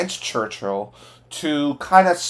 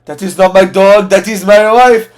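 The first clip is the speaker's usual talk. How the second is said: loud, high barked soldier's line; German soldier's line; 6 semitones higher, 10 dB louder than usual. Retold or imitated. Reviewer acting out a German soldier's line, loud and high. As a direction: shout it like a stiff German guard. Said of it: "and the German's like".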